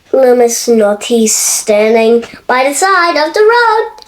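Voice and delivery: ordinary delivery, nothing marked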